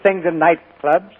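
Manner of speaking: ordinary talk, nothing marked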